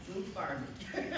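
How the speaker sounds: usual speaking voice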